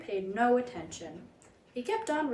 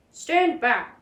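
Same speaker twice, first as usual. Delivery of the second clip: louder command to the crowd; warning call; 3 semitones higher, 8 dB louder than usual. A narrator's reading. Attendant ordering the crowd back, firm call. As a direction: order people back firmly. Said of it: "one of them said to the crowd".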